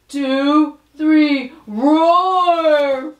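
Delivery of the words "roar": roaring like a lion; big lion roar at full voice; loud lion roar. Pretend. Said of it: "I'm gonna make this sound really loud".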